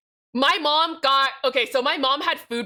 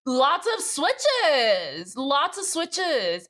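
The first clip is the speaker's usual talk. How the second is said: awed drawl; 3 semitones higher, much slower than usual. Real, not acted. Browsing switch website in awe.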